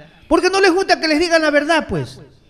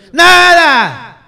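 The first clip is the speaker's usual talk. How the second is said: loud, higher flat refusal; one sharp shouted word; emphatic no; 5 semitones higher, 8 dB louder than usual. Real, not acted. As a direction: slam the door on the idea. Angrily rejecting union protection.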